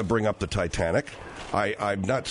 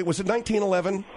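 raised pitch, mimicking the guest; mocking imitation; 6 semitones higher, faster than usual. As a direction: quote it back in a mildly mocking higher voice. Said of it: "you're mocking my voice".

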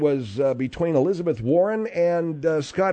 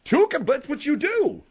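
very high, silly parody of a woman cohost; mock her right back in a squeal; retaliatory mimicry; 12 semitones higher, 4 dB louder than usual. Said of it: "two can play at that game".

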